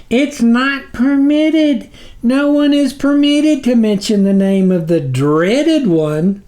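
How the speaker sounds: high, slow pleading servant voice; groveling minion. A narrator's reading. Snuffer begging the sublord's patience, high and slow. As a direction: plead upward to your dark master.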